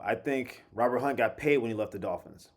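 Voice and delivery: ordinary talk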